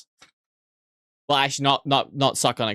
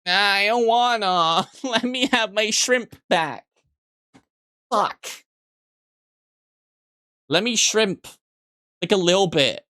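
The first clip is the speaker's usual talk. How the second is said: put-on pouty little-kid whine, higher; whiny childish pleading; 7 semitones higher than usual. Whiny pleading for shrimp back.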